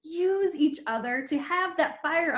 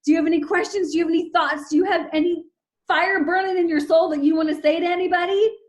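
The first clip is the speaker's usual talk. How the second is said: higher and louder, laughing plea; eager pleading; 6 semitones higher, 8 dB louder than usual. Real, not acted. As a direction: beg the group to chime in. Pleading for the audience to speak.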